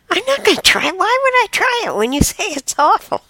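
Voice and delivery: very high, quick protest; protesting raccoon. Acted. Raccoon refusing what the other calls awful, high protest. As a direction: argue back in a squeaky-high voice. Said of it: "the other raccoon says".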